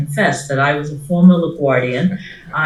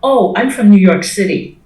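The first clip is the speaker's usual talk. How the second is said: retold teen answer; 5 semitones higher, 5 dB louder than usual. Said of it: "and I said".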